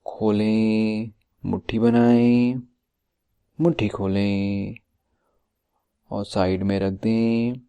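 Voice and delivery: measured instructions with drawn-out endings and long pauses; guided meditation. Acted. Coach guiding listeners to tighten and relax muscles, guided meditation voice. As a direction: lead the body relaxation step by step, stretching the last syllables.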